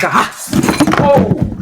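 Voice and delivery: high, loud comic scream of alarm; mock panicked yell. Storyteller spotting two cop cars, hammed-up panicked yell.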